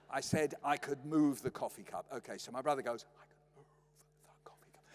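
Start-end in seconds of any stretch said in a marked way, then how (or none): 3.2-4.8 barely audible whisper; silent mouthing. Retold or imitated. Brother plotting at breakfast, barely audible whisper. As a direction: mouth it with hardly any breath. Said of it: "If you can't lip read me".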